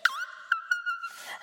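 very high startled shriek; high fright squeal. Acted. Chick seeing the cat, high shriek. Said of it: "she went".

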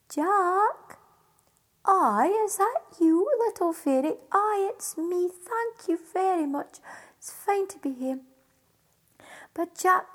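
high, lightly voiced fairy call; silvery fairy voice. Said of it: "a wee silvery voice called down".

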